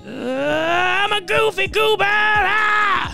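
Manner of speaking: high slow screaming yell. Acted. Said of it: "he screams to the sky".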